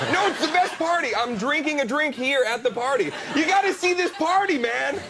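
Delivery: a partygoer who can't contain himself; overexcited partier. Retold or imitated. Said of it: "somebody keeps running in going".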